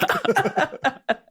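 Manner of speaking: sudden high laugh; high burst of laughter. Real, not acted. Host laughing at New York accent joke.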